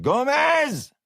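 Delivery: long high holler across the room; hollered name. Acted. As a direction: call him from the back. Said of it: "and hollered".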